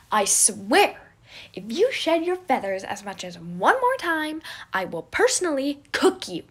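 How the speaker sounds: loud, high, over squawking birds; shouting. Acted. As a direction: yell at the birds, exasperated. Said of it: "Remus shouted".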